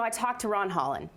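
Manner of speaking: ordinary talk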